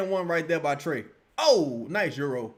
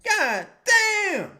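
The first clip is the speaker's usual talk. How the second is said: anguished cry; 11 semitones higher, 3 dB louder than usual. Real, not acted. Anguished reaction to the game.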